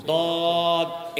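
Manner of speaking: "দদ" long and heavy, tongue against the molars; molar-side "দদ". Shown as a demonstration. Preacher demonstrates the molar letter, "দদ" drawn out with tongue on back teeth. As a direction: hold "দদ" as a heavy Arabic dad. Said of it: "জিহ্বার গোড়ালি মারির দাঁতের গোড়ালির সঙ্গে লাগে".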